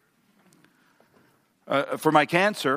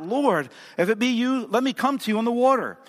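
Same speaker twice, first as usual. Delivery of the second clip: crying out; 9 semitones higher than usual. A narrator's reading. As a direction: call out in eager, high-pitched appeal. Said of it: "He cried out".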